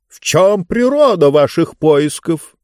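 lightly acted pompous Mister Speaker; pompous talkative ruler. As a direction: draw the question out imperiously.

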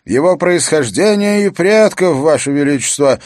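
loud, high herald's announcement; formal proclamation. A narrator's reading. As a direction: proclaim the charge to the whole court. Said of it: "объявил он".

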